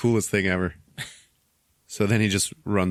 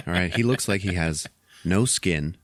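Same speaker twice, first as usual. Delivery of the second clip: usual speaking voice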